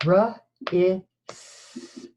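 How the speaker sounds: R, I, st, each sound split apart; sounding out words. Shown as a demonstration. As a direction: segment wrist into R, I, st with pauses. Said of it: "next sound".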